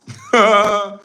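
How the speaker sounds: high, loud burst of laughter. Real, not acted. Amused disbelief at commenter.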